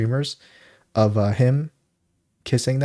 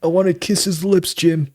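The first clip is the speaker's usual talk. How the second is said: higher-pitched, put-on character voice; film character impression; 9 semitones higher, slower than usual. Co-host quoting a movie goon talking to Jim, hammed-up. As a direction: quote the goon in a raised voice.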